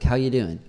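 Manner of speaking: "How you doing?" run together fast and loud; casual shortened. Shown as a demonstration. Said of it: "if you're saying it like that".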